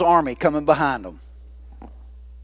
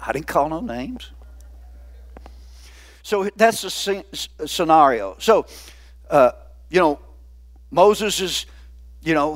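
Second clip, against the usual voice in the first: usual speaking voice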